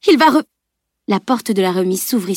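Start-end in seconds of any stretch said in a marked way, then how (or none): 0.1-0.4 high, loud, cut off mid-word; urgent warning broken off suddenly; alarmed plea, abruptly interrupted; pleading. A narrator's reading. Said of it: "le supplia Camille".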